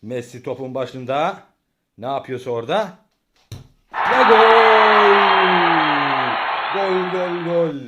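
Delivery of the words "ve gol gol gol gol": high, loud goal call; screaming football commentator. Acted. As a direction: yell the goal like live TV. Dad commentating home football.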